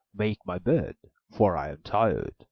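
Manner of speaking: loud, slow ogre order; the ogre orders, tired; an ogre tells the maiden to make his bed, loud and slow. A narrator's reading.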